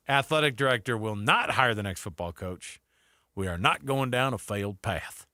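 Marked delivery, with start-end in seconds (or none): 3.3-5.3 put-on quoting the Louisiana governor in a mild Louisiana accent. Radio host quoting the Louisiana governor, light Louisiana accent. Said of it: "I said it with that accent".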